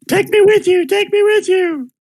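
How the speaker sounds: high-pitched, loud pleading cries; screaming children. Acted. Kids screaming at Zenith to take them in the boat, high screams. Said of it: "run down here screaming at Zenith".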